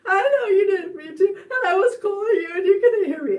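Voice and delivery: loud, high mock wail; high blubbering whine; tearful mess. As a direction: re-enact sobbing out an explanation. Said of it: "I was just such a mess".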